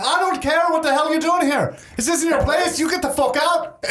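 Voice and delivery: high-pitched angry tirade in character; irate cave woman. Acted. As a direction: throw them out as a furious cave dweller. Voices angry Fiona throwing them out.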